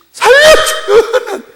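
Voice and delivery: high, drawn-out mock-desperate scream; crying out to God. Dying sinner begging God for help, desperate cry. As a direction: holler it out long and high as if pleading for life. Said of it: "하나님 부르짖어".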